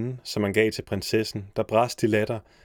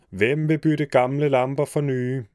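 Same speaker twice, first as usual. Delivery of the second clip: street vendor's call; 4 semitones higher than usual. Acted. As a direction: hawk it to the whole street. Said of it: "gav sig til at råbe".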